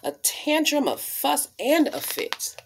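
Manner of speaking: expressive picture-book storytelling voice; reading to children. Acted. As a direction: read it like storytime on the rug.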